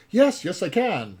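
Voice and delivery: high, loud burst of eagerness; eager. Retold or imitated. Apprentice promises master to keep a secret, eagerly. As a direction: jump in as the keen apprentice. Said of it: "the apprentice eagerly says".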